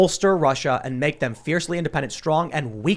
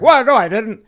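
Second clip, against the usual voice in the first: much higher, louder mock denial; mock Biden denial; 8 semitones higher, 9 dB louder than usual. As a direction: ham up the flustered old man denying it. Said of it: "He's like".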